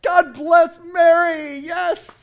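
high, loud, slow exultant cry; excited shout. Retold or imitated. A woman in the crowd shouting praise for Mary. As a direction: shout it out, overjoyed.

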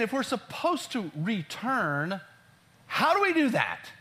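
people's puzzled question, pitched high. Retold or imitated. People replying to God about returning, questioning.

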